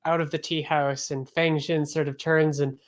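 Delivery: ordinary talk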